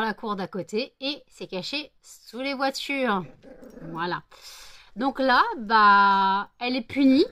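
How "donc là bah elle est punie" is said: loud, slow scolding of the dog. Real, not acted. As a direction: tell the dog off loudly. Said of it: "pour lui montrer que je suis pas contente".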